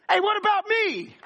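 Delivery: high, loud whiny protest; jealous bystander. Acted. Bystander complaining that Jesus picked the beggar, high whiny yell. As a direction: squeal it like a left-out kid. Crowd member feeling left out.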